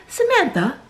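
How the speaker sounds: put-on high, posh British soap accent; Yorkshire pudding accent. Soap character calling Samantha at the table, mock British accent. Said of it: "that Yorkshire pudding accent".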